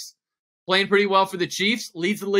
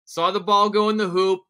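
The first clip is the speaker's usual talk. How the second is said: ordinary delivery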